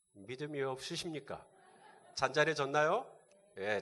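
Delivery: normal talking voice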